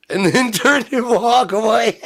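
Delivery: high, loud, real laughing fit. Real, not acted. Telling how they'd walk away from the driver, laughing. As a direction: crack up mid-sentence, pitch shooting up.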